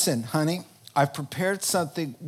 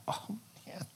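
ordinary talk, nothing marked

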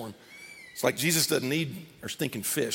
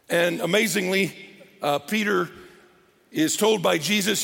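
normal talking voice

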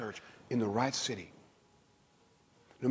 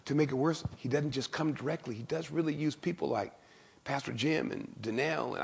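ordinary talk

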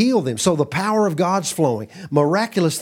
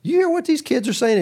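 indignant priests; 13 semitones higher than usual. Retold or imitated. Indignant priests confront Jesus.

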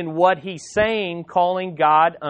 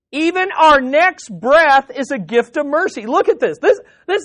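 rising higher and louder with joy; real excitement; 7 semitones higher, 4 dB louder than usual. Real, not acted. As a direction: preach it with growing delight. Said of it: "I got excited about this. Can you tell?".

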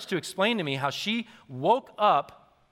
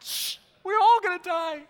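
much higher hammed-up hushing; mock panicked dad; 4 dB louder than usual. Father hushing the kids at dawn, mock panic. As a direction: play the spooked dad for laughs. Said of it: "And I'm like".

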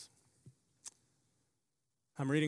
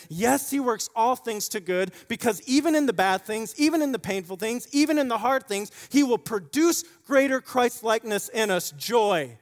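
loud, high, rising preaching build; full-force preaching; 8 semitones higher, 9 dB louder than usual. Acted.

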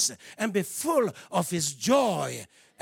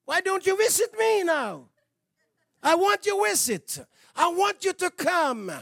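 pleading shout; 7 semitones higher than usual. Acted. Preacher calling out to God.

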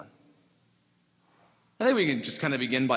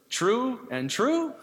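"true and true" drawn out in a higher German accent, the "th" of "through" said as "tr"; sermon joke turning "through and through" into "true and true", German accent; 9 semitones higher, much slower than usual. Shown as a demonstration. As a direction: lightly mimic the German farmer's "true and true" for "through and through". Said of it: "True and true".

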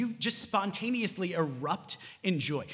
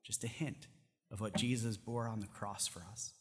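prayer; 4 semitones lower than usual. Acted. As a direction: close the sermon in hushed prayer. Closing prayer.

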